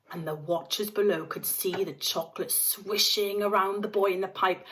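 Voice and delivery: ordinary delivery, nothing marked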